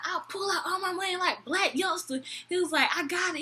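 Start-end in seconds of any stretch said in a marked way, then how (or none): 0.0-2.2 high-pitched imitation of a mad drunk; mock indignant boast. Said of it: "so freaking mad". 3.0-3.4 high-pitched drunk guy imitation; insist, pitched up, as the drunk customer; mock indignant boast. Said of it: "so freaking mad".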